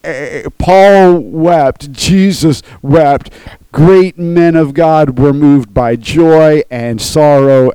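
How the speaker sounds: loud, high, slow preaching; impassioned preaching. Acted. Preacher urging the men of God, loud and slow. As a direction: drive it home from the pulpit.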